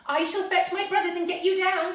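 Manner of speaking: high, loud frightened outburst; in a fright. A narrator's reading. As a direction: blurt it in a panic. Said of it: "in a fright".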